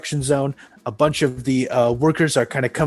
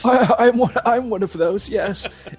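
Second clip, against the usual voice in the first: timid worker; 8 semitones higher than usual. Acted. Timid worker voiced high.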